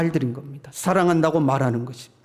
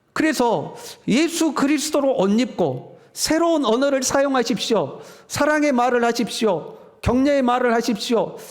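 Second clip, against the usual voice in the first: preaching; 7 semitones higher than usual. Acted. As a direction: urge the congregation from the pulpit. Preaching exhortation.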